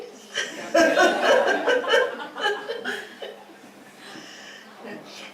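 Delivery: high, breathy giggle; real laugh at the joke. Real, not acted. Speaker laughing at her own pity-party act, high giggle.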